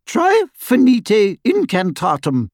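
put-on high, slow, precise girl; Hermione voice. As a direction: spell it out carefully, pitched high.

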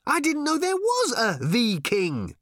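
high-pitched shouted protest. A narrator's reading. Ford replying to Arthur mid-gallop, high shout. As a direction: call it back over the din. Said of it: "shouted Ford".

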